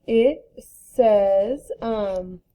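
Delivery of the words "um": usual speaking voice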